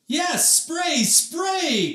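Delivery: high excited shouts; crying out. A narrator's reading. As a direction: cheer it out loud. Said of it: "cried out Mom and Emily".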